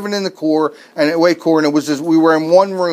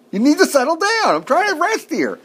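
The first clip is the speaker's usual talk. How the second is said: exasperated dad; 10 semitones higher than usual. Retold or imitated. Dad yelling at noisy kids.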